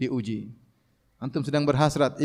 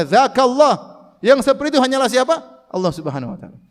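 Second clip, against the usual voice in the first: forceful rebuttal; 7 semitones higher, 6 dB louder than usual. Retold or imitated. Prophet's reply voiced forcefully.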